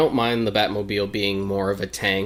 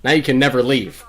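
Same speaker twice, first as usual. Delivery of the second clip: raised, loud mock threat; mock-menacing developer; 4 semitones higher, 7 dB louder than usual. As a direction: play the spiteful developer trapping players. Joining in as the fed-up developers.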